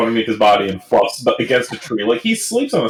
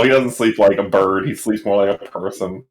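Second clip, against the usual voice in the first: ordinary delivery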